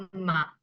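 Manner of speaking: mamá with a held double m, softly falling; doubled m. Shown as a demonstration. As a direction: lean on the m in mamá. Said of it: "para que suene un poco más fuerte".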